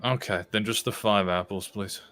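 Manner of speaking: ordinary talk, nothing marked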